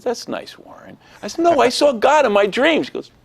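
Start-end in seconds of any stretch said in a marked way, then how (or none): 0.0-1.0 put-on soft, whispery humoring of a child; placating mother. Mother humors her son's dream claim, softly dismissive. 1.4-2.8 put-on high, loud protest of a little kid; insistent child. Boy insists to mother about his dream, high and loud.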